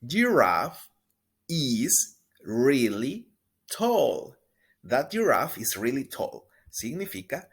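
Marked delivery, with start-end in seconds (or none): 0.1-4.4 slow, loud, clear teacher model; drawn-out pronunciation model; slow model sentence. Shown as a demonstration. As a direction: stretch each word for repeating. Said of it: "Una vez más".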